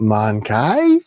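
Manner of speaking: drawn-out "Mankai", second syllable rhyming with "eye"; playful mispronunciation. Shown as a demonstration. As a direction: sound out "Mankai" slowly, as if reading the odd spelling. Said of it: "it's spelled with an I E".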